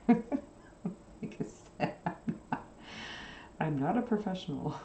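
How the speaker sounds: talking through real laughter; laughing. Real, not acted. Crafter excusing her messy stitches, laughing. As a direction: laugh helplessly through the words.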